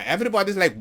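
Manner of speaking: ordinary delivery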